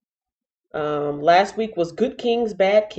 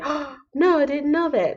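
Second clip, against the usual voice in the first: high, mock-innocent surprise; 8 semitones higher, 3 dB quieter than usual. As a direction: act surprised, high and soft. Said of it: "Then I'll say".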